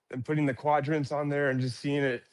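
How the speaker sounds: ordinary talk, nothing marked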